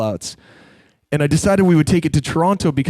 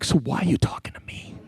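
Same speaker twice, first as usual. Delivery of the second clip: hushed, voiceless comic aside; soft whispered question; 8 dB quieter than usual. Retold or imitated. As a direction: whisper it as a retold retort. Acts out aside in story.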